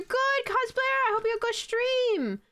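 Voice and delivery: high-pitched, bubbly well-wishing; chirpy high farewell to a viewer; cheery send-off. Real, not acted. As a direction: send them off in a high, upbeat voice. Greeting a departing raider cheerily.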